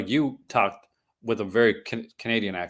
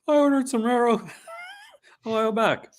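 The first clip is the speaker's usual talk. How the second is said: higher, whiny customer; mock customer voice; 12 semitones higher than usual. Host mocks a waiting customer, voice raised and whiny. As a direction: play the fed-up buyer.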